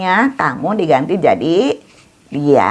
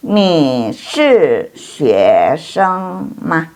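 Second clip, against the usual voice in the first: very slow, syllable by syllable; slow model reading; much slower than usual. Shown as a demonstration. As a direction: stretch each syllable so students can copy. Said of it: "teacher models Chinese question".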